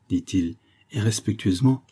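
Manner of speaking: ordinary delivery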